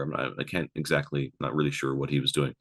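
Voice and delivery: normal talking voice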